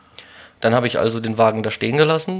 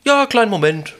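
shop clerk voice; 9 semitones higher, 6 dB louder than usual. Retold or imitated. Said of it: "Hier, das ist meine Nummer".

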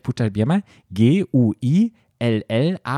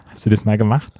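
ordinary delivery

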